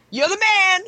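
excited admiration. Retold or imitated. Acted greeting to admired cosplayer.

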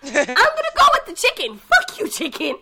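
high, laughing yell; excited yell. Retold or imitated. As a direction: shout at the chicken. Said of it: "you kinda gotta go".

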